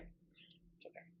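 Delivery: soft self-soothing whisper; breathy slow it's okay; whispered reassurance. Real, not acted. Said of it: "It is all right".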